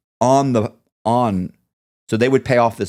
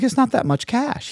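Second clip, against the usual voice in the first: incredulous. Retold or imitated. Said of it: "I'm like".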